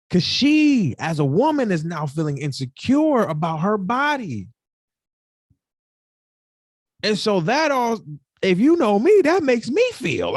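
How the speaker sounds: fighting back tears while talking; choked up. Real, not acted. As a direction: voice climbing high with emotion. Choking up recalling girlfriend's insecurity.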